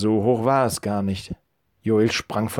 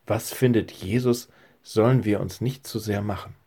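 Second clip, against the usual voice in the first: ordinary delivery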